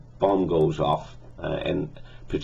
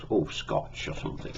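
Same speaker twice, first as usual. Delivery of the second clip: whispered aside; 7 dB quieter than usual. Retold or imitated. Bond muses over his glass.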